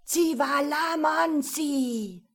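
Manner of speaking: slow, high, booming spell; loud incantation. A narrator's reading. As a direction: call out the spell loudly. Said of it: "lautstarken Stimme des Magiers".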